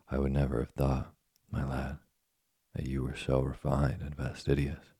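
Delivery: ordinary delivery, nothing marked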